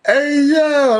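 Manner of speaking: gleeful yell; excited high shout. Real, not acted. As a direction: burst in laughing excitement. Excited laughing retell.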